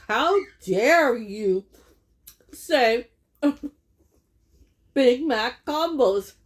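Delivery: slow, loud, playful indignation, laughing through it; mock outrage. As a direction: tease like a scandalized cashier. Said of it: "She was joking with me".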